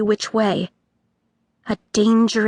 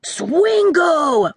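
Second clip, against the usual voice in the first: higher excited exclamation; boy crying out; 7 semitones higher, 3 dB louder than usual. A narrator's reading. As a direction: blurt it in shock. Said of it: "cried another boy".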